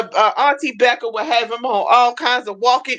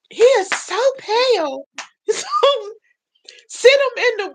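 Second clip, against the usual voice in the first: high surprised doctor voice; 13 semitones higher than usual. Retold or imitated. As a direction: exclaim like a startled doctor. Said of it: "the doctor was like".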